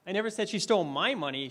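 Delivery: "my" lifted and stressed before "money"; single out "my" as the key word. Shown as a demonstration. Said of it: "I emphasized different words".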